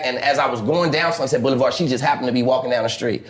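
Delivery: ordinary talk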